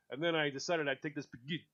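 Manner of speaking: a hint of a showy Italian accent; mock Giada Italian. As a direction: imitate a TV chef rolling Italian words. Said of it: "she go".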